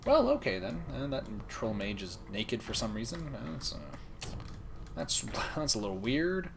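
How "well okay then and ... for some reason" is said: normal talking voice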